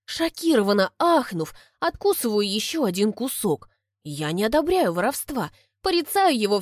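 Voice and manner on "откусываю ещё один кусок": ordinary delivery, nothing marked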